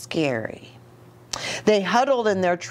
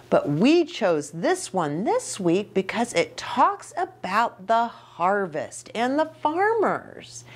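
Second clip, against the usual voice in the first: ordinary delivery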